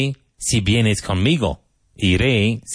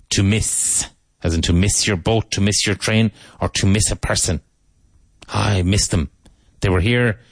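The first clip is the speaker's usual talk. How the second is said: ordinary delivery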